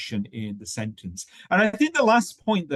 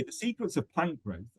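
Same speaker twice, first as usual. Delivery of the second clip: "The secrets of plant growth" as one group, then a clear pause; phrase then pause; 3 semitones higher, 7 dB quieter than usual. Shown as a demonstration. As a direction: read it as one thought group and stop. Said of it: "and pause".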